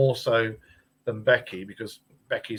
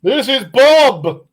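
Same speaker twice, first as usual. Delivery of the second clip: loud, slow, high presentation; big cheery reveal; proud reveal; 11 semitones higher, 14 dB louder, much slower than usual. Real, not acted. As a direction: announce the cat like a showman. Showing the cat proudly.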